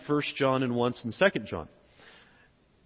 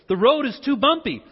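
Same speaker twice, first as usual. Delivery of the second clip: high, louder imitation of wife; mock complaint, pitched up; objecting wife; 8 semitones higher, 5 dB louder than usual. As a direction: voice your wife's objection. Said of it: "and she said".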